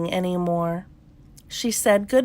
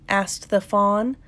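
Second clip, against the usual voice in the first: usual speaking voice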